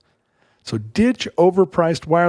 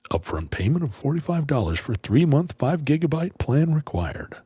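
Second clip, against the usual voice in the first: softer, understated fine-print read; ad disclaimer; 3 semitones lower, 5 dB quieter than usual. Acted. Host reading Mint Mobile terms to listeners, soft disclaimer voice. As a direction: read out the legal terms quietly.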